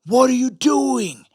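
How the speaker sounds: high, drawn-out exasperated demand; imitated scolding. Dad scolding his son on the field, imitated scolding. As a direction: scold like a drill sergeant dad. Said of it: "I originally would have been like".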